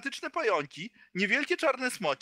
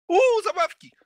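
high, loud delighted squeal; excited squeal; 11 semitones higher, 8 dB louder than usual. Acted. GM voices excited NPC.

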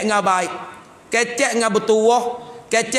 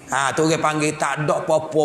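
ordinary delivery

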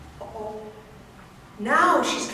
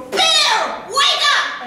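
much higher and louder yell; shout; 9 dB louder, much slower than usual. Acted. Fox yelling to startle the bear awake. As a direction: jolt him awake. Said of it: "and he goes".

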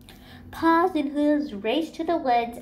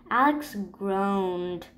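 ordinary delivery, nothing marked

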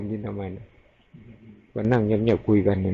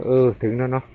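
calm senior monk; 4 dB louder than usual. Retold or imitated. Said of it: "ท่านก็บอก". (เสียงช้า นุ่มนวล เลียนคำพูดหลวงปู่)

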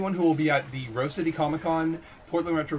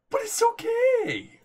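squeaky mock voice; 5 dB louder, slower than usual. Sarcastic squeaky reassurance.

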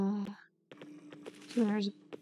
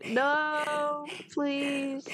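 higher, pleading scared voice; horror game streamer; 6 semitones higher than usual. Real, not acted. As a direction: beg the monster to stop. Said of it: "horror game streamer".

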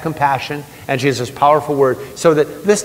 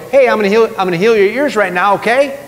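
higher, louder, offhand announcement; Jesus calling out casually; 7 semitones higher, 4 dB louder than usual. Acted. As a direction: pipe up as if telling someone what's coming, ending high. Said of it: "Jesus can't go".

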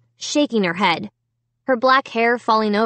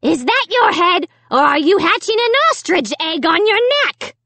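jeering dummy; 8 semitones higher than usual. A narrator's reading. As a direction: heckle him as the nasty dummy. Dummy goes on jeering Dad.

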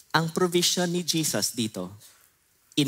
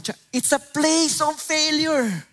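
much higher, slow and louder; dramatic declaration; 13 semitones higher, 4 dB louder, slower than usual. Real, not acted. Preacher proclaiming the charcoal fire as a place of failure, high and slow. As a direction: hit failure with weight.

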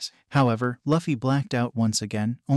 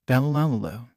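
a rolling, mocking villain's laugh. World laughing at the Empress on the snail, mocking villain laugh. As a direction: laugh like a bully who enjoys the fight. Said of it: "World laughed".

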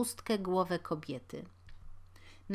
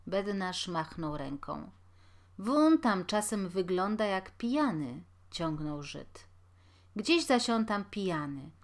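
plain reading voice

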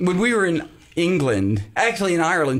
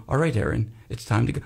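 low, soft bedtime call; playing a gentle bedtime ringtone, pitched way down; 8 semitones lower, 4 dB quieter, faster than usual. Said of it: "Maybe a bedtime sound too".

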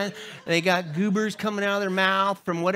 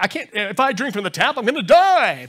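louder melodramatic wail; mock panic; 5 semitones higher, 8 dB louder than usual. Preacher mocking water snobs, loud fake panic. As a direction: overplay the fear of tap water.

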